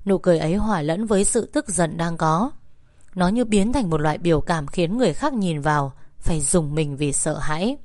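ordinary delivery, nothing marked

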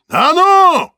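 very high, loud bark; a strong man roaring at starving people; powerful yell. A narrator's reading. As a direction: roar with a strong, well-fed voice. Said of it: "заорал кто-то голосом сильным".

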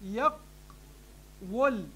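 يقول split slowly as "yaq... wul"; original underlying form. Shown as a demonstration. Teacher showing students the word's origin, syllable by syllable. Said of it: "الكلمة أصلها".

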